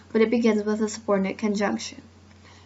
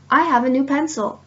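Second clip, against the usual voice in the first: excited exclamation, a bit higher and louder; strong feeling; 4 semitones higher, 5 dB louder than usual. Shown as a demonstration. Teacher demonstrating an exclamation to students, excited and emphatic. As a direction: say it like thrilling news. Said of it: "there I showed strong feeling".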